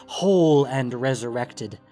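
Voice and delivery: ordinary talk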